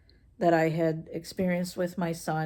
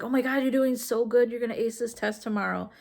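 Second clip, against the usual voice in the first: put-on high, eager cheering for a kid; bubbly encouraging parent; excited praise; 6 semitones higher, 3 dB louder, faster than usual. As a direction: gush over him like he's already aced it. Parents praising son during quiz.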